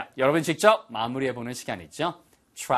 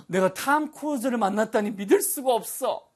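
higher-pitched, acted amazement; amazed disbelief; 7 semitones higher than usual. Teacher acting out meeting a film star, amazed disbelief. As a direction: play starstruck disbelief.

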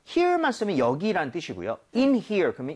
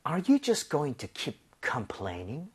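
slow demo read; much slower than usual. Shown as a demonstration. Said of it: "같이 한번 가볼게요".

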